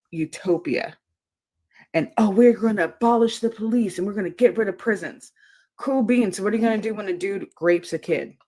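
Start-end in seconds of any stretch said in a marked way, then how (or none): 2.2-6.9 mocking voice. Mocking leftists' utopian talk.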